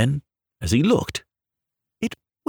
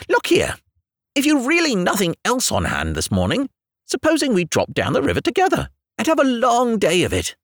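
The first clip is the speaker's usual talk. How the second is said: cheerful water rat; 11 semitones higher than usual. Acted. Rat's long cheerful speech.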